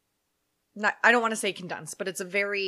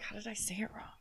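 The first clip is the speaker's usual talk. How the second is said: whisper; 15 dB quieter, faster than usual. Retold or imitated. Quoted own thought, whispered.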